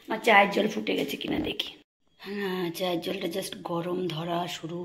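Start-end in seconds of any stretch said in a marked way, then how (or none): none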